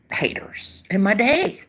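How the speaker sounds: slow, sly whispered aside; slow conspiratorial whisper. Real, not acted. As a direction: whisper it like a juicy secret. Sly aside about religious men.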